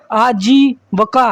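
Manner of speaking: आजीविका spelled out very slowly; slow classroom आजीविका; slow wrong form. Shown as a demonstration. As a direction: lay out आजीविका syllable by syllable. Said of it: "आजीविका वर्तनी की दृष्टि से गलत है".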